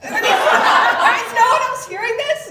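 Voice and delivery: very loud, high, laughing outrage; outraged shouting. Real, not acted. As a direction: yell in disbelief. Horrified shouting at the ad.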